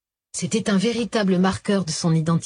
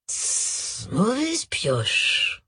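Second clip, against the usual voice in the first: slow dismissive tut then drawl; dry retort; 2 semitones higher, 3 dB quieter, much slower than usual. A narrator's reading. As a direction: knock his lie down with a tut. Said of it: "rétorqua Joseph".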